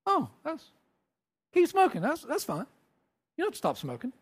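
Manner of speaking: high, breezy and laughing off; amused casual man. Retold or imitated. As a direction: brush it off lightly. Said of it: "he said".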